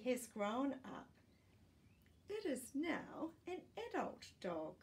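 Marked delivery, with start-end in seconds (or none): none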